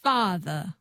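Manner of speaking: "Father" ending in a schwa; transatlantic dropped R. Shown as a demonstration. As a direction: copy "Father" with no final R. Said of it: "you drop the R and you make it a schwa".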